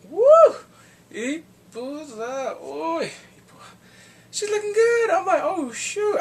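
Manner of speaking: loud, high whooping; excited cheering. Real, not acted. Viewer hyping up the heroine's new look, excited cheering. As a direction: cheer her on from the couch.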